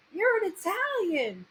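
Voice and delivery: high-pitched imitation of Italian-American excitement; mock Italian-American. Host imitates guest at first meeting, high mock Italian-American. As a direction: exclaim it high with a playful Italian-American lilt. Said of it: "She's like".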